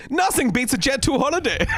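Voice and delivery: high-pitched mock holiday advert; advert voiceover parody. Podcaster parodies the Jet two advert, high-pitched.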